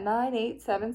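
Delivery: ordinary delivery, nothing marked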